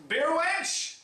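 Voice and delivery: high mock-lordly call for service; calling for a wench. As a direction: shout for the barmaid like a pompous lord. Calling the server mock-grandly.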